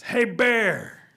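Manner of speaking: loud, high holler; calling out. Acted. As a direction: holler to get their attention. Rabbit calls out again.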